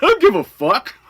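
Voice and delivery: high, loud exasperated outburst; worked-up retold yell; retold angry comeback.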